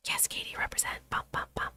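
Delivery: hushed, voiceless whisper. Real, not acted. Host cheering on Katie in an aside, whispered. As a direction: whisper it very softly.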